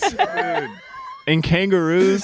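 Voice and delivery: bad Australian accent; mock Aussie accent. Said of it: "Is that an Australian?".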